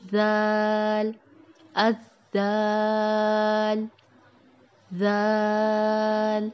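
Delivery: زال with the tongue tip on the upper teeth, soft and stretched very long; soft, drawn-out zal. Shown as a demonstration. Teacher models زال slowly for students, soft and stretched. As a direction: ease into زال gently and hold it. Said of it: "نرمی سے ادا ہوگا".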